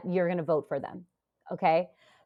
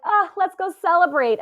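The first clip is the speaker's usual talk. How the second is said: high, loud imitation of others cheering; mock celebration; 9 semitones higher, 6 dB louder than usual. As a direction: mimic the crowd's excited relief. Acting out everyone's cheer.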